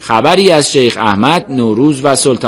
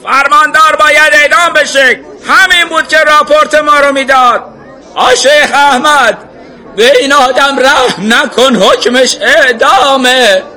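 high, loud cries from the crowd; angry shout; 4 dB louder than usual. A narrator's reading. Man in the crowd demanding execution from sheikh, shouting. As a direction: yell the demand, stretching the final words. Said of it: "یکی فریاد زد".

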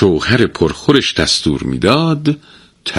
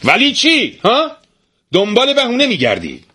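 much higher, sharp yell; a wife yelling at her excuse-making husband; shouting. A narrator's reading. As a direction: cut him off, angry. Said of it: "زن سرش فریاد کشید".

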